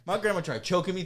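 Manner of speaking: ordinary talk, nothing marked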